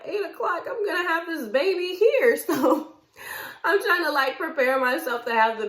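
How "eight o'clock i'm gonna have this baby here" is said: high, louder incredulous outburst with a laugh; retold disbelief. Wife in labor told to wait, laughing disbelief. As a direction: balk at the idea, pitched way up.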